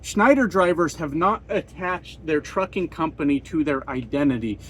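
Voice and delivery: ordinary talk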